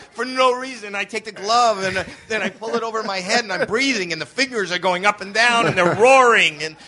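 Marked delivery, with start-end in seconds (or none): none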